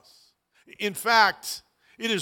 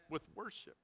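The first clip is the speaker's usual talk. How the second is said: soft echo; 21 dB quieter than usual. Shown as a demonstration. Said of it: "filled with worship".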